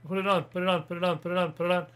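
excited repeated urging. Real, not acted. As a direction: egg them on excitedly. Urging partner, excited chant.

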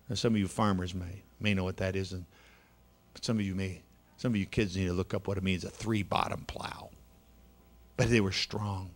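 ordinary delivery

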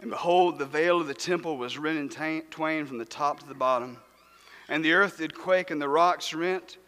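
soft, low reading of the verses; quiet scripture reading. Acted. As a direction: read the old text gently aloud.

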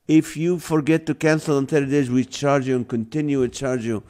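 usual speaking voice

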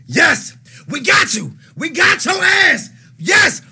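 high, loud victory yell; triumphant shout. Real, not acted. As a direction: cheer like you caught someone. Triumphant over catching Ellie.

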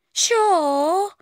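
high questioning woman; woman's voice. A narrator's reading. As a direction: check on her lightly. Colleague voiced in story.

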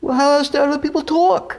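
put-on loud, high, thick New York accent. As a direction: talk like a fast New Yorker. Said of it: "where they talk like that".

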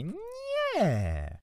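high, drawn-out obstinate insistence; stubborn. A narrator's reading. Boy correcting aunt about fraternizing, stubbornly. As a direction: stretch each phrase high, refusing to budge. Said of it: "odparłem uporczywie".

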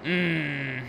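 ordinary delivery

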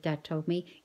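ordinary delivery, nothing marked

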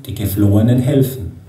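ordinary talk, nothing marked

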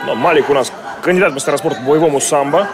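usual speaking voice

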